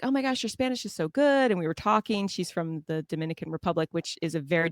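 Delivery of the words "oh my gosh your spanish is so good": retold gushing praise. Retold compliment from a friendly mother.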